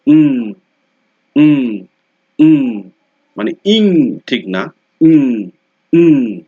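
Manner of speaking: উম said loud and high, nasal ng ring; NG sound demo. Shown as a demonstration. Teacher loudly drilling the ng sound for students. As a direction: belt out উম to show the ng. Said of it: "এটাকে বলা হচ্ছে উম".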